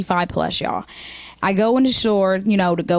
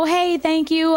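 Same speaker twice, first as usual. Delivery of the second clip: higher, overly sweet thanks; polite brush-off; 7 semitones higher than usual. Retold or imitated. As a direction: sweetly back away. Polite brush-off to old man.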